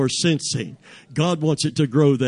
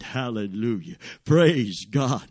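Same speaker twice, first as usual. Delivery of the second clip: ordinary talk, nothing marked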